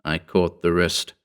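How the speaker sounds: plain reading voice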